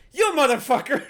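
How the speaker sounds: loud, high furious yell; outraged shouted insult; snapping angrily. Acted. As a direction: lash out at full volume. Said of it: "snapping, finally pointing at him".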